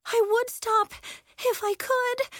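high, strained plea; desperate. A narrator's reading. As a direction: plead helplessly while struggling to stay afloat. Terrified girl treading rising water.

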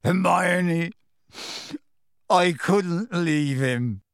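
tearful. A narrator's reading. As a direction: plead through tears, drawn out. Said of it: "tears now trickling down".

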